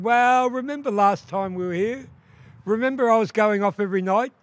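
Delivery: hint at the secret lover; coy confession. Acted. Duck woman tells her secret.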